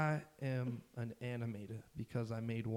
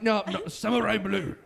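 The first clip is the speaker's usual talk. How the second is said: high, loud, laughing outcry; mock cry; 7 semitones higher, 9 dB louder than usual. Acting out a knife joke, loud hammed-up cry. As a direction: shout it like a hammed-up battle cry.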